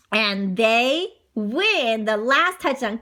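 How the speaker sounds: usual speaking voice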